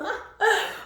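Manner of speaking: high loud laugh; burst of laughter. Real, not acted. Reader laughing at the card spread. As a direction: laugh out at the joke.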